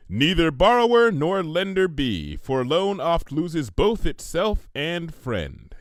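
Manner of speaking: slow, measured quotation; solemn recitation. Acted. Twilight quotes Shakespeare to Pinkie, solemn recitation. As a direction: recite like a proverb. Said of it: "she recited".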